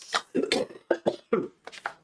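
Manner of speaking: harsh hacking cough; a rough, raspy cough from the chest; smoker's cough. Real, not acted. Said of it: "smoker's cough".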